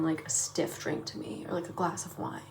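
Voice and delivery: breathy murmur. Real, not acted. Savoring the food.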